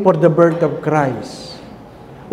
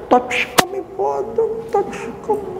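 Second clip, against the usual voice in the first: high, soft tearful voice; mock-sobbing mourners, pitched high; weepy mourners; 6 dB quieter than usual. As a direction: whimper it like grieving family. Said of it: "iyakan kayo".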